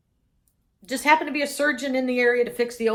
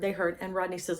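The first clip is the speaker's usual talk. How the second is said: ordinary delivery, nothing marked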